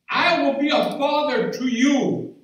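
preaching, stressing I; rising preacher voice. Acted. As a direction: proclaim it to the congregation.

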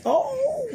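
very high, held "ooh"; sudden high squeal of delight; playful whoop. Real, not acted. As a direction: let out a high, teasing whoop. Playful whoop about curves.

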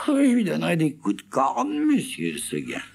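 très aiguë, crâneuse; chèvre bravache. Acted. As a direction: faire la fière. (la chèvre crâne, très aiguë)

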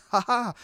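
high, short mock "ha ha"; imitated weird laugh. Mimicking a singer's laugh mid-song, weird laugh. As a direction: put on an odd high-pitched laugh. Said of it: "this weird laugh".

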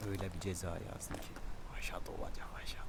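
whispering. Real, not acted. Gleeful whispered threat about penalty.

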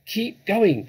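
high, loud order; shouted. A narrator's reading. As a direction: shout it over to the others. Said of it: "the fat Boggis shouted".

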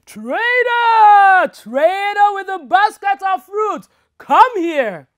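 loud, high booming call; bellowing. Acted. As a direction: bellow from inside the tree. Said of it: "Aldo bellowed out from the tree".

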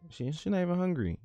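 high, stunned she not even hungry; incredulous. Real, not acted. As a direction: sound baffled, pitch up. Incredulous at baby game.

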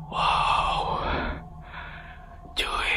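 awed whisper. Real, not acted. Entering a dark stuffy room.

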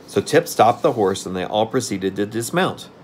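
ordinary delivery, nothing marked

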